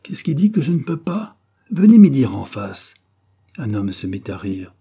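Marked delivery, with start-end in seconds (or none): 0.0-2.9 high protest building to loud shout; heated, raised-voice challenge in storytelling. A narrator's reading. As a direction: dare them, punching out the last sentence. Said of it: "cria-t-il".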